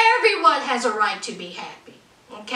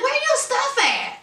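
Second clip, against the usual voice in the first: much higher, incredulous question; high-pitched disbelief; 10 semitones higher than usual. Retold or imitated. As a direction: squeak it out in shock. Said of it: "I think I said".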